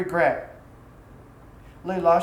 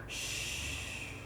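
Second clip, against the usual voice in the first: breathy, voiceless hush; a gentle soothing shh; whispered shush; 14 dB quieter than usual. A narrator's reading. Wife soothing her despairing husband with a whispered shh. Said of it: "and she whispered".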